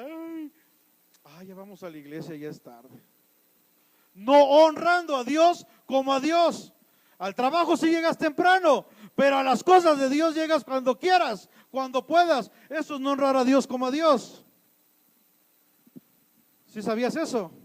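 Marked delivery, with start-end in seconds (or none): 0.0-3.0 lazy sigh. Retold or imitated. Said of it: "y otros en su casa". 4.1-14.4 louder, higher preaching burst; fiery preaching. Acted. Pastor scolding latecomers to church in a fiery, louder preaching voice.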